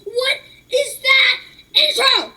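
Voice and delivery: high, slow outraged yell at the intro; incredulous shout. Real, not acted. Kid yelling at a cringey video intro, outraged. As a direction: shout it out in disbelief.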